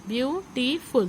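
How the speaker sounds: "Beautiful" as three separate beats, view, टी, फुल; teacher's three-part breakdown of "beautiful"; syllable by syllable. Shown as a demonstration. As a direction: sound out each syllable of "beautiful" with short gaps. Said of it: "three syllable word".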